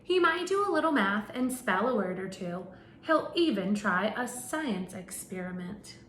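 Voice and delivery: animated picture-book narration; reading to children. Acted. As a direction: read it out to a class of little kids. Reads picture book to class.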